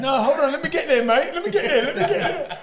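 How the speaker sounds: high, laughing let-me-get-there; laughing protest. Real, not acted. Boxer laughing as he stops the host skipping ahead. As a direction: laugh while holding the host off.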